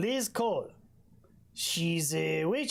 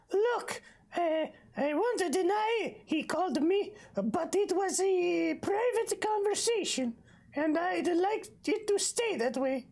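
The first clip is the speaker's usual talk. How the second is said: put-on Bull's accent. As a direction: squeak it like a shifty hotel manager. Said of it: "Let me try".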